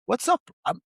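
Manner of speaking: ordinary delivery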